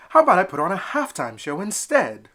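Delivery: a little duck pitching his own idea; eager little duckling. Acted. Elmer offers a halftime show.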